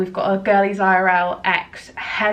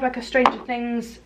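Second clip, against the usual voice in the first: ordinary talk, nothing marked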